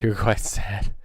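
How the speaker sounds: usual speaking voice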